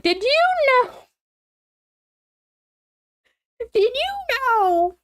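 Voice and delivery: high, drawn-out gossip voice, slow and teasing; gossiping grandma. Retold or imitated. Grandma on the phone, spilling gossip in a high, slow tease. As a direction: lean in like a grandma with juicy news. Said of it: "she would be like".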